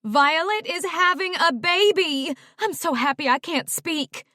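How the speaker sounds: high, loud, thrilled announcement; overjoyed. Acted. Mom telling dad the baby news, overjoyed. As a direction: burst with happiness.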